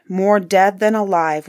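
ordinary delivery, nothing marked

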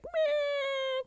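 high mock bird cry; phoenix cry. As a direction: squeak like a weeping bird. Phoenix crying voiced.

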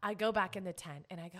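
usual speaking voice

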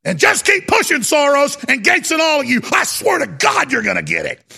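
real angry threat. Real, not acted. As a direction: lose your temper on air. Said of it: "I shouldn't be on air".

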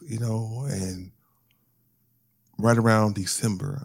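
ordinary talk, nothing marked

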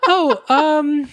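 high, evasive, caught-out voice. Retold or imitated. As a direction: fake innocence, voice jumping up high. She dodges his honesty question.

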